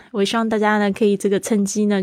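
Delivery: ordinary delivery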